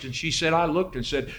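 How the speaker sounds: normal talking voice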